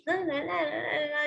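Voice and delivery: lightly voiced bubbly chatter in la-las; a hint of singsong bubbliness; bubbly person. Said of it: "a bubbly person on and she's".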